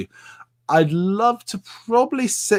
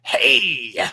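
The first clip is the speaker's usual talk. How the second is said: Tiki impression; much slower than usual. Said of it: "there's Tiki".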